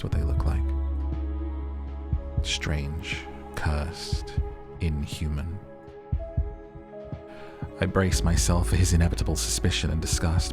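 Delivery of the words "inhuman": ordinary delivery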